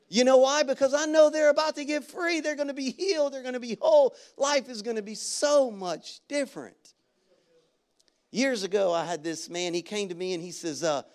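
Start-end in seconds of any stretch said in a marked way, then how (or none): none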